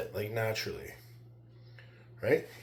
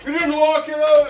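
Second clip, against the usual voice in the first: mocking critic; 8 semitones higher, 5 dB louder than usual. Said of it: "You guys complain".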